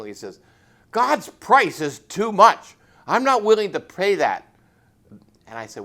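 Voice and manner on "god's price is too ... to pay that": angry refusal. Retold or imitated. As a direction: slam it down like a fist on the table. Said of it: "he slammed his fist down on the table".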